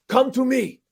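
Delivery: high-pitched, riled-up locker-room demand; fired-up teammate. Retold or imitated. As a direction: imitate a fiery teammate demanding attention. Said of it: "He's all mad and jacked up".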